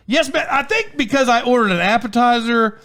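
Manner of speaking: high-pitched acted answer to a waitress; mock insistent reply. Diner confirming his order to waitress, high mock insistence.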